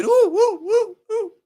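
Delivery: high, loud "woo woo" noises; mock wooing. Mocking how people talk back, high loud wooing. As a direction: hoot a string of high, loud woos.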